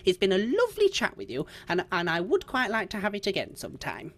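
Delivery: put-on fond old woman Margaret. Old lady parting from her new friend, fond and chatty.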